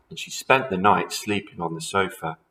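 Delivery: plain reading voice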